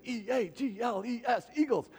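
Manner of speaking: high-pitched chanted spelling cheer; Philly fan cheer. Acted. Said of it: "you do the same thing".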